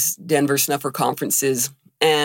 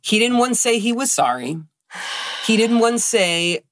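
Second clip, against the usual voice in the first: higher-pitched, hurt indignation; indignant; 8 semitones higher than usual. Real, not acted. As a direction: stress the grievance, pitched up. Indignant at father's lack of sympathy.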